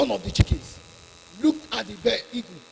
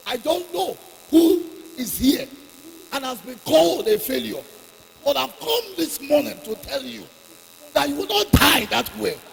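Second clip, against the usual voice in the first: loud, driving sermon delivery; fiery preaching; 7 dB louder than usual. Real, not acted. Preacher exhorting the congregation not to give up, fiery. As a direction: preach it hard to the crowd.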